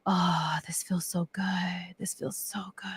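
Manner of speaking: very soft, unvoiced-breath whisper of relief; blissful whisper. Woman retelling her near-death inner voice, blissful whisper. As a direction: whisper it as if drifting in peace. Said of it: "I heard my voice going".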